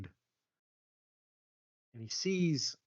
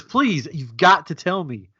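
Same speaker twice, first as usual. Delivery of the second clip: mock plea, higher and drawn out; pleading; 4 semitones higher, 4 dB louder, slower than usual. Visitor begging the chocolatier for his secret, mock pleading. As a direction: beg for it slowly, hammed up. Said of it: "And he says".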